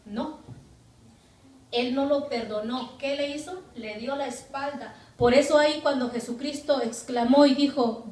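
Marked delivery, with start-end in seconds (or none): none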